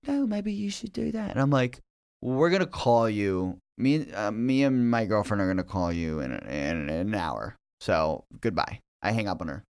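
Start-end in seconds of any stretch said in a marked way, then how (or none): 0.0-1.3 high, hushed British lady; imitated British landlady, unruffled; calm British landlord. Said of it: "She said it so calmly". 2.2-8.8 low, controlled phone sign-off; retold cold goodbye, voice dropping; restrained anger. Acted curt phone sign-off to landlord.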